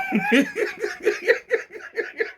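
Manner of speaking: high, breathless laughing fit; squealing with laughter. Real, not acted. Shrieking with laughter over the friends.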